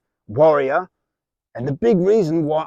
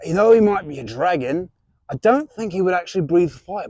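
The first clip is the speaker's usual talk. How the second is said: broad put-on Australian accent, hearty host; jolly Australian reptile presenter; Australian wildlife host. Plays a crocodile-hunter style host.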